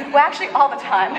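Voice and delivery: laughing aside, quick and amused; real laugh over a joke to the class. Real, not acted. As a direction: tack it on through a laugh. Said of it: "laughing at own remark".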